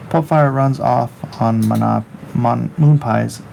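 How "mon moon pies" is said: ordinary talk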